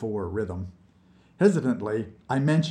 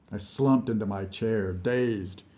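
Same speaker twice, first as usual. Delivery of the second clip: ordinary delivery, nothing marked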